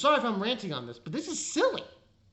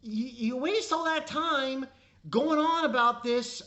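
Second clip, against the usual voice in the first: usual speaking voice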